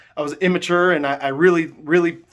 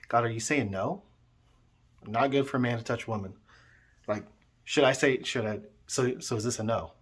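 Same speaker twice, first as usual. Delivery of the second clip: soft, lower, hesitant questions; subdued questioning; 4 semitones lower, 8 dB quieter than usual. Retold or imitated. Young man asking God about marriage, subdued questioning. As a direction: puzzle it out quietly in prayer.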